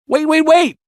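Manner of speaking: high, urgent pleading; hurried plea to hold on. A narrator's reading. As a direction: beg them to stop. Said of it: "she pleaded".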